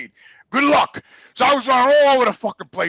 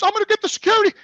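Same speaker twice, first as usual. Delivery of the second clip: nurse voice; 12 semitones higher, faster than usual. Retold or imitated.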